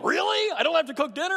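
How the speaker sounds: high, surprised and eager question; delighted disbelief. Retold or imitated. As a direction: squeal it like the best news of the day. Wife delighted at eating out.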